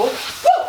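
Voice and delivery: sudden high-pitched whoop; short high "woo". Shown as a demonstration. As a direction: punctuate the leg flick with a woo. Vocal cue for leg flick.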